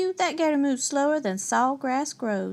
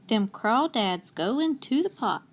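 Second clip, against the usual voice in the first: ordinary delivery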